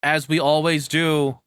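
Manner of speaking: loud, worked-up complaining, high and punchy; heated rant. Real, not acted. Co-host ranting loudly at the West over lost fun. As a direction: vent it hard like a fed-up fan.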